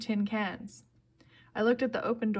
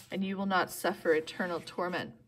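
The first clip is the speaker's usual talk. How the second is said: plain reading voice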